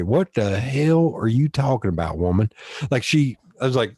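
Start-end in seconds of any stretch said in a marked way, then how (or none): 0.0-2.5 put-on thick Southern twang; strong Southern accent. Shown as a demonstration. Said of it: "make that Southern accent come out really strong".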